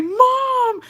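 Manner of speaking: high, drawn-out scream for mom; squealed mock cry for help; retold scream. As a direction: imitate a terrified kid screaming. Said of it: "I started fucking screaming".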